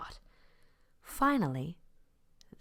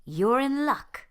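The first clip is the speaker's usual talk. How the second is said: high, louder chirpy duck; cheerful duck voice; 10 semitones higher, 8 dB louder than usual. A narrator's reading. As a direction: brightly tell the lion his luck. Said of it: "said Mary Ann".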